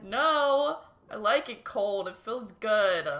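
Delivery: loud, insistent retold objection, drawn out at the end; emphatic protest. Inwardly contradicting boyfriend about the cold water, loud protest.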